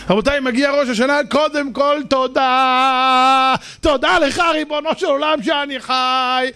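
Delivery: slow, drawn-out thanks to God, rising to a cry; fervent prayer. Acted. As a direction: pour out thanks like a cantor. (תפילה נלהבת ואיטית עד צעקה)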